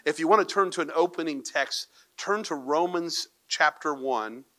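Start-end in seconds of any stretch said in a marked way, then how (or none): none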